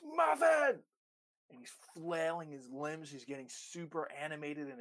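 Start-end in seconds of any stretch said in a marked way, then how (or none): 0.0-0.8 calling the dog's name at the top of his voice; loud call to dog. Retold or imitated. Professor calling the dog across the highway, loud high call. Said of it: "He goes".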